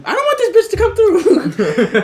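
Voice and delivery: high slowed laughing dread; laughing high panic. Retold or imitated. Worker hiding in the back, high laughing panic. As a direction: squeal it through a laugh. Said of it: "I was like".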